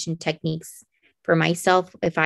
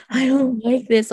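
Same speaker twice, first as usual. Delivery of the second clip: mock grumbling kid, pitched higher; imitated child's protest; 6 semitones higher than usual. Mother imitating her son refusing help, mock protest. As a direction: put on a frustrated child's complaint.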